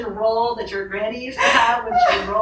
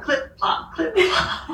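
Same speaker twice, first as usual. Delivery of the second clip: whispered clip-plop of a broken heel; breathy footstep noises, half laughing; mimicked broken shoe steps; 7 semitones higher, much slower than usual. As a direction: tap out a limping shoe sound. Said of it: "So now I'm like".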